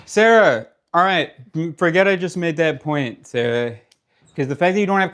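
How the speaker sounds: loud, high-pitched burst of exasperation; exasperated. Real, not acted. Exasperated in argument with partner.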